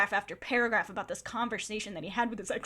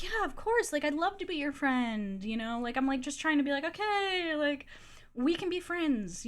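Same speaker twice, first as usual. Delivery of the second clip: placating; 4 semitones higher than usual. Retold or imitated. Trying to comfort a crying man, placating. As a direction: humour him in a higher voice.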